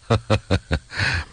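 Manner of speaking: light acted laugh in short bursts; hearty chuckle. A narrator's reading. Soldier amused by a comrade's question, hearty chuckle. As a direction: chuckle openly, in character. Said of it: "활짝 웃으며 말했다".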